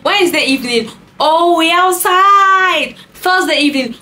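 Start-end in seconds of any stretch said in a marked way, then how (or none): 1.2-2.9 high, loud, long-drawn mock holler; mock a party boy, stretching it right out; always-outside party guy. Party man reporting his Wednesday evening, loud high mocking call. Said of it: "those men that are always outside".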